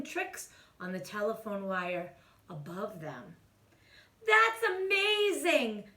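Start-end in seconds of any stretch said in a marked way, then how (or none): 4.3-5.9 high, slow gush of delight. A narrator's reading. Sisters gushing at their brother's tricks. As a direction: gush over the kitten's tricks. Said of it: "gushed the girls".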